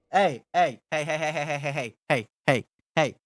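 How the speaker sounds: a volley of quick heys; rapid-fire heys. Real, not acted. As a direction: fire off heys to cut chat off. Rapid repeated interjection at chat.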